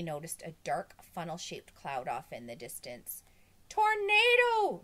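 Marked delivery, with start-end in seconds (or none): 3.7-4.8 high, loud, drawn-out scream; screamed alarm. A narrator's reading. Girl spots a funnel cloud and screams, high and long. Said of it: "she screamed".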